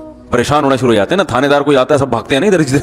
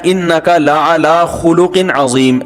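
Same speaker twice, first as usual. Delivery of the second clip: Quranic recitation; slower than usual. Acted. Quran verse quoted in sermon.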